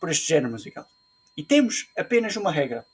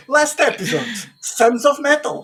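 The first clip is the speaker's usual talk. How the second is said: announcer; 8 semitones higher, 5 dB louder, slower than usual. Pretend. Announcing title dramatically.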